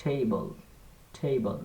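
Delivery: "টেবল" as thei-bol, aspirated. Shown as a demonstration. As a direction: model "টেবল" with breathy T. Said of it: "উচ্চারণটা হবে".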